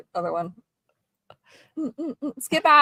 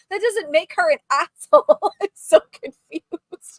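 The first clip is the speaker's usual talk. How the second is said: ordinary delivery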